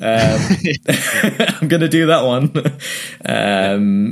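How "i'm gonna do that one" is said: laughing through the words, higher and louder. Real, not acted. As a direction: giggle it out. Laughs while quoting himself.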